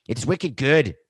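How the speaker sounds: put-on a second weak stab at a Boston accent, wicked good. Host retries a Boston accent for the listeners, mock. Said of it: "trying to have a Boston accent".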